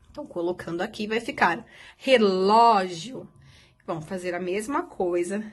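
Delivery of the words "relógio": "relógio" slowly, with a long open ó; open stressed vowel. Shown as a demonstration. Teacher reading out the completed word, slow open ó in "relógio". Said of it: "com o som aberto".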